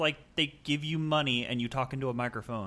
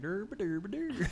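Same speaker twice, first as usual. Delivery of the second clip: Swedish chef mimic; 8 semitones higher, 5 dB quieter, much slower than usual. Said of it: "you said it with, like, a Swedish accent".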